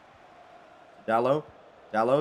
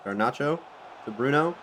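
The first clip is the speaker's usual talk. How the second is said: ordinary talk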